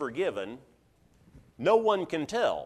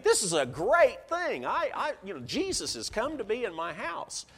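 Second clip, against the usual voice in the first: higher-pitched, excited host's thought; delighted host; 7 semitones higher than usual. Acted. Proud host thinking of Jesus in the house, delighted. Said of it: "You think".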